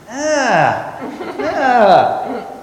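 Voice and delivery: put-on high, loud wordless whine of a little kid; whining small child. Niece pointing at treats on the fridge, high childish whine. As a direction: imitate a preschooler's insistent squeal.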